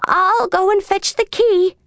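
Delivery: tag. A narrator's reading. Old lady voiced in story.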